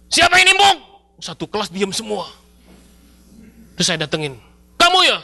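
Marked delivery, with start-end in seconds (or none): 0.1-0.8 high, loud angry yell; shout. Retold or imitated. Boy yells at classmates, high furious shout. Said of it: "saya teriak". 4.8-5.2 high, loud demanding challenge; angry accusation. Retold or imitated. Boy confronts the chalk thrower, loud and high.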